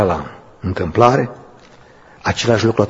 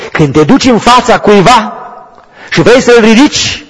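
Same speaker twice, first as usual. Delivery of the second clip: high and loud, calling out to the church; preaching at full force; 12 semitones higher, 8 dB louder than usual. Real, not acted. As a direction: lift them up with your voice. (predică cu putere)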